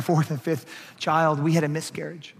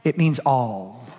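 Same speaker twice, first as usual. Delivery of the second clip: slow reveal; 5 semitones lower, slower than usual. Shown as a demonstration. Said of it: "This is gonna blow your mind".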